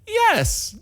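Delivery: mock high-pitched girlfriend yes; high delighted yes. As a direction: squeal the yes like his girlfriend. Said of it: "she was able to look at me and say".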